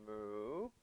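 low, soft mooing; cow moo. Shown as a demonstration. Teacher leading the kids in cow pose, low moo. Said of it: "cow pose".

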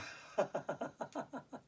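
a light breathy giggle; airy giggle. Pretend. Teacher showing a heart laugh, breathy giggle. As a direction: giggle softly as an example. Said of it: "laugh in your heart and go".

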